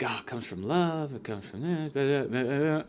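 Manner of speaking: hushed parody of pious comfort; mock soft preachy. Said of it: "She's like".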